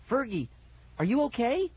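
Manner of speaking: high, small voice calling out; tiny, shrill call. A narrator's reading. Boy calling his friend in the dark, tiny and shrill. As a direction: call up high, thin and unsure. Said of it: "My voice came out tiny and shrill".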